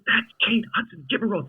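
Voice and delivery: high-pitched insistent friend; mock urgent nagging. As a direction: imitate a friend frantically prompting. Friend urging him, acted out.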